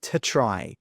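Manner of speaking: ordinary talk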